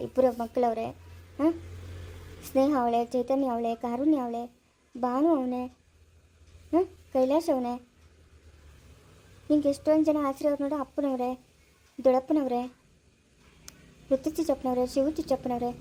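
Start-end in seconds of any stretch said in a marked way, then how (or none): none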